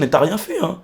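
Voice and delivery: normal talking voice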